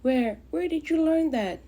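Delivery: high, breathless surprise; surprised. Acted. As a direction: gasp out the question.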